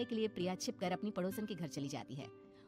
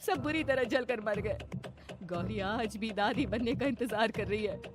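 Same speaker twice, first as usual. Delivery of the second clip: grave mother-in-law; 5 semitones higher, 5 dB louder than usual. Acted. Mamta telling bahu about the dead neighbours, anxious. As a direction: reveal a horror, rising to loud alarm.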